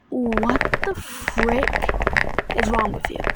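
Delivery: drawn-out, slow "what the frick"; slow, incredulous retort; indignant retort. Retold or imitated. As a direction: stretch it out slowly in disbelief. Retold indignant comeback to friend's insult.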